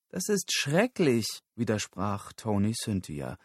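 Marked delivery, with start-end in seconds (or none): 0.1-1.4 high, drawn-out put-on voice; distressed objection. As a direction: protest in dismay, stretching it out. Figur widerspricht entsetzt im Streit. (entsetzter, hoher Widerspruch)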